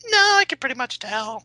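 high, smug brush-off; mock dismissive voice. A date's high, smug brush-off. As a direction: sniff them off without meeting.

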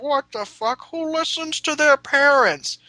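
high, loud, slow scoff; mock the kids who scoff at curfew; mocking teen punks. School punks scoffing at curfew, high mocking voice.

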